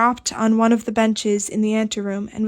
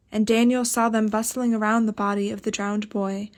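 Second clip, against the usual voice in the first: ordinary delivery, nothing marked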